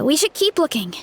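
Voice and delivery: normal talking voice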